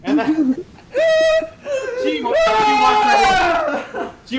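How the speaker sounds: can barely get it out for laughing; giggling through the story. Real, not acted. Cracking up at own story.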